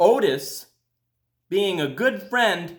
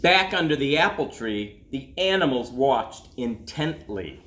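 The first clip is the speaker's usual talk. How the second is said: put-on gentle storybook reading for children; calm read-aloud to kids; story narration; 4 semitones lower than usual. A narrator's reading. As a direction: read it like a bedtime picture book. Reads a picture book aloud.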